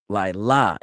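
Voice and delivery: "Laila" demonstrated with the weight on its ending; stress on last syllable. Shown as a demonstration. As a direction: hit the final syllable of "Laila", lai-LA. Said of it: "with the emphasis on the last syllable".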